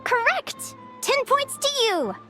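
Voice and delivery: high, delighted announcement; excited quiz host. Acted. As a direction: award points in a high, cheery voice. Quiz host rewarding right answer.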